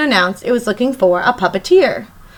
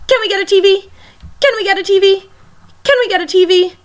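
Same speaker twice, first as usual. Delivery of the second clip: put-on begging child; 8 semitones higher, faster than usual. Boy begs parents repeatedly.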